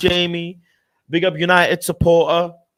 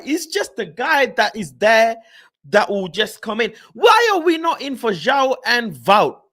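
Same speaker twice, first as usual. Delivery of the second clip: ordinary talk, nothing marked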